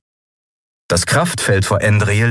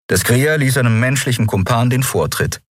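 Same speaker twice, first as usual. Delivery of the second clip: usual speaking voice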